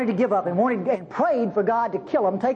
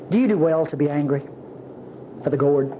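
lower, grave voice of God asking; 7 semitones lower than usual. Acted. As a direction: speak as God gently questioning. God speaks to Jonah.

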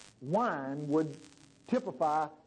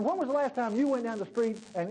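usual speaking voice

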